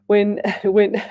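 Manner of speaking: laughing between words; real laughter. Real, not acted. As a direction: giggles breaking up the sentence. Breaks up laughing while reading.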